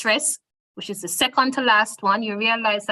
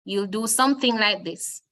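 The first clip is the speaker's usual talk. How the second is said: ordinary talk, nothing marked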